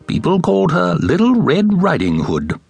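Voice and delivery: usual speaking voice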